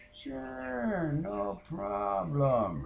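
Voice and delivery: high, very slow, drawn-out agreement; mocking Rico's agreement. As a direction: drag it out in a mock-agreeable high voice.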